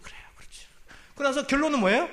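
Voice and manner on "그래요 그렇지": whisper. Retold or imitated. Comforting a struggling friend.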